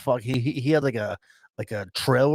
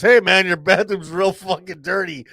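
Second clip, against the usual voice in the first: loud, slow, higher sarcastic remark; mock innocent taunt; 6 semitones higher, 7 dB louder than usual. Buddy taunting the gas station owner, loud sarcastic drawl. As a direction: rub it in with fake friendliness. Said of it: "my buddy goes".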